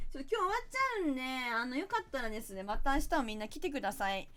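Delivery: ordinary talk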